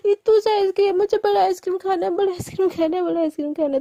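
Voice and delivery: childish, high-pitched mock tantrum; acted pouty outrage, starting high and loud; angry. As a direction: protest in baby talk, settling as it repeats. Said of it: "और ज़्यादा गुस्सा हो जाती".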